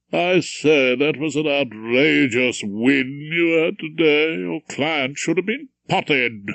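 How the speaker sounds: a hint of a condescending father; proud old judge showing off his son; lightly voiced pompous judge. Judge presents his son to Rumpole, mildly pompous.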